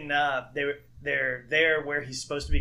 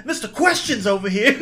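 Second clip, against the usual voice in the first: high, loud shout broken by laughter; hammed-up mobster yelling shut up; mock angry shout; 11 semitones higher, 7 dB louder than usual. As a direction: snap at him, laughing.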